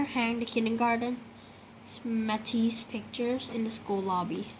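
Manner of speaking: ordinary delivery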